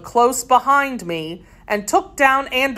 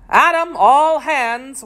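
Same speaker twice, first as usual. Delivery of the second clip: rally the crew to attack; pirate roaring the charge, a touch hammed; thundering roar; 6 semitones higher than usual. A narrator's reading. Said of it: "roared in a voice of thunder".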